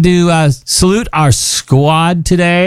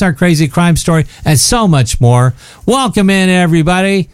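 radio announcer; 5 semitones higher than usual. Acted. Radio show intro.

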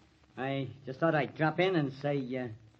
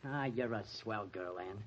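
low, soft and fond; hushed, affectionate compliment; tender reassurance; 10 semitones lower, 10 dB quieter than usual. Acted. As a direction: comfort her gently. Comforting friend's worried girlfriend.

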